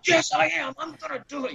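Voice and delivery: high-pitched stubborn retort; arguing back. Retold or imitated. Climber arguing with lady below, high and stubborn. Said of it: "I'm arguing with her".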